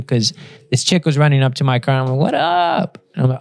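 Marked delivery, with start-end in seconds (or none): 2.2-3.0 high-pitched, drawn-up question; mock casual greeting. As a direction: toss it out high, as a comic quote. Acting dazed reply after the crash.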